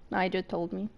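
normal talking voice

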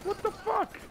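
high, disbelieving yelp; stunned high cry; shocked outcry. Real, not acted. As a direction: squeal it in pure disbelief. Shocked by enemy drones.

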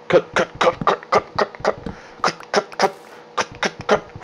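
fast whispered chant, fading; frantic whisper. Real, not acted. Hurrying to cut wood before mobs come, frantic whisper. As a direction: whisper it urgently under your breath. Rushing to chop wood, panicked.

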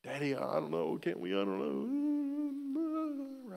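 soft whine stretching into a high wail; mock childish whimper, last words drawn out high; imitated upset child. Upset daughter to dad over slimed favorites, imitated whiny wail. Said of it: "She was upset".

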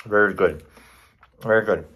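muffled words between bites; talking while chewing. Real, not acted. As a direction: mouth partly full of food. Seen 1: Takes a bite at -4; lips pressed, cheek bulging while speaking.

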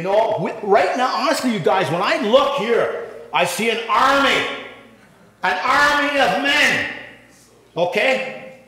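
rally the men like an army; rising preaching. Real, not acted.

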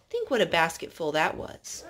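usual speaking voice

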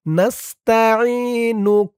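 slow, drawn-out Arabic reading of নাস্তাইনুক; careful recitation. Shown as a demonstration. Teacher modelling the word for students, slow and drawn out. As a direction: stretch নাস্তাইনুক slowly and stop on the final ক. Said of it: "উচ্চারণ করতে হবে".

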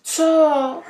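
fake crying. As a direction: pretend to be in tears. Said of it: "budu dělat dobreč". (předstíraný pláč)

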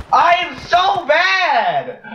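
long high wailing cry; drawn-out wail. Real, not acted. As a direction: stretch it into a wail. Self-mocking wail after a miss.

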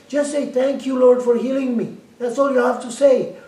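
loud, high, urgent plea; retold insistent begging at full volume. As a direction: plead forcefully, pitched well up. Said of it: "begging her".

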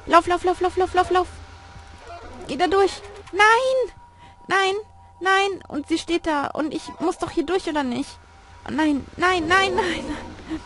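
high, urgent panicked cries; frantic yelling while fleeing; being chased. Real, not acted. Fleeing the monster in game.

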